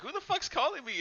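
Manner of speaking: high, puzzled mock grumble; mock bewildered old man looking around. Voodoo master hearing the doll, high and puzzled. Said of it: "he looks at the other side".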